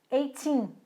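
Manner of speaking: put-on "eighteen" with the weight on "teen"; "eighteen", light start and stressed, falling "teen"; stress on the second syllable. Shown as a demonstration. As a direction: lean on the final "teen" of "eighteen". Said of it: "a sílaba tônica tá no teen".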